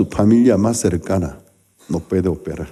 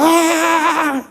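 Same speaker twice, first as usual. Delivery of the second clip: high imitated scream of pain; a sudden high yelp, imitating the patient. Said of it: "entonces él gritaba". (grito agudo imitando al paciente)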